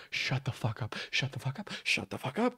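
very quiet, low repeated mutter; muttered disbelief. Real, not acted. Reactor mutters at the burrito remark, hushed disbelief.

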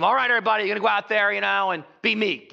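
loud, high rallying coach; mock coach pep talk. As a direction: fire up the team like a coach, tongue in cheek. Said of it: "Coaches don't rally their team".

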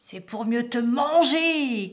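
slow build to a loud pounce; wolf's cry. A narrator's reading. Wolf revealing himself to the girl, slow loud cry. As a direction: burst out loudly on the last word. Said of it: "cria le loup".

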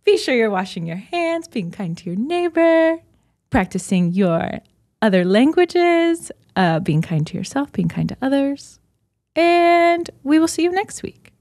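bright high announcement voice; sign-off like a cabin announcement; flight attendant. Real, not acted. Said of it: "I sound like a flight attendant".

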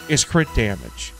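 normal talking voice

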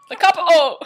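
a playful yelled British "The capital!"; loud mock British exclamation.

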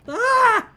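short, very high loud shriek; startled scream. Real, not acted. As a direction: shriek as if just hit. Killed in game.

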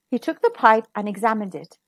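ordinary delivery, nothing marked

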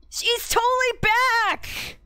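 very high, slow, gleeful; squealing excited friend; thrilled Gregg voice. Acted. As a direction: stretch it out, overjoyed.

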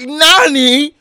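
mock Kaido. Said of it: "He's like".

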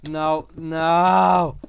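loud, rising "no, no" in panic; alarmed yell. Real, not acted. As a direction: shout it as the goal slips away. Opponent about to score.

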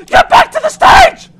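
very high, loud order; commanding shout. Real, not acted. Player orders Pop Goes back to the stage, commanding shout. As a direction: yell it at a runaway.

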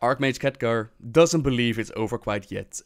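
plain reading voice

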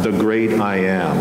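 ordinary talk

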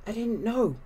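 high, small whispered reply. A narrator's reading. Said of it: "both the sisters whispered back".

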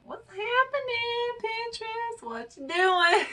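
mock incredulous. Mock scolding the website.

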